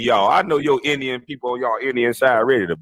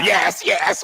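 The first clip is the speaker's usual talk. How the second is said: higher, overeager mimicked yeses; mock eager interrupting; 4 semitones higher than usual. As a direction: parody a fawning co-host cutting in.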